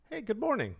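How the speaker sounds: high, lilting animated greeting; animated high-pitched greeting; bright greeting. Retold or imitated. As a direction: sing out a hello, pitch swooping high. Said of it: "like that".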